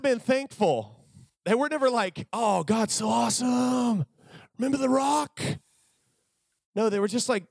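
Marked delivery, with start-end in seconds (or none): 2.3-5.4 mock grateful crowd. Israelites that never thanked God, high mock gushing. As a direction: gush thanks to God, hammed up.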